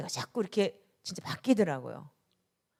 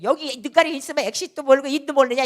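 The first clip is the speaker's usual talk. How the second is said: louder, higher imagined yell at the foreigner; 6 semitones higher, 11 dB louder than usual. Retold or imitated. Pastor imagining how she once yelled at a stranger, louder. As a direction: bark it as the hot-tempered old self. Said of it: "소리를 질렀을 거야".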